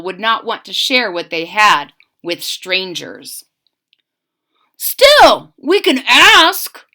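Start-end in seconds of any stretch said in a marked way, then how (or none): 4.8-6.8 higher, louder, slow soldier voice; soldier urging. A narrator's reading. Soldier encouraging his tired comrades, higher and louder. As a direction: push them on, we can still ask. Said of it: "said the first soldier".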